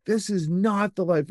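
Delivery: mildly tearful realization. Retold or imitated. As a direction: let it catch as if about to cry. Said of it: "and I was, like, crying".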